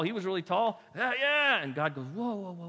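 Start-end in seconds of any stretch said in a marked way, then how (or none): 0.9-1.6 eager agreement. Retold or imitated. 2.1-2.7 halting God. Retold or imitated.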